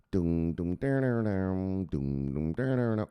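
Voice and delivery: hummed music. Retold or imitated. Imitating music on the phone.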